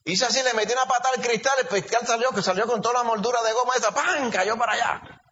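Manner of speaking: high and quick, boasting about the kick; mock-proud, rushing through the feat. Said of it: "hay gente que dice".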